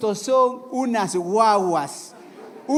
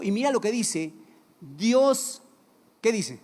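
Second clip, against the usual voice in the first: ordinary talk, nothing marked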